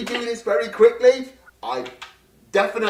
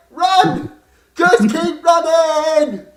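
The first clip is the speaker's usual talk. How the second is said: high, loud panicked shout; panicked yelling; 7 semitones higher, 6 dB louder, much slower than usual. Acted. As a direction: scream at everyone to flee. Fleeing giant monster.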